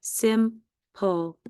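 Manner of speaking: SIMPLE as "sim", pause, "pull"; syllable-by-syllable simple; split into syllables. Shown as a demonstration. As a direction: say Simple in two slow separate beats. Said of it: "Do that final stable syllable".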